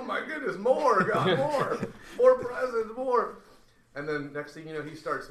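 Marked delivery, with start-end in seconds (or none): 0.0-3.4 much higher and louder, crying out for more; ecstatic shouting. Retold or imitated. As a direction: shout it out in wild joy, pleading for more. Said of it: "Now faith is exploding".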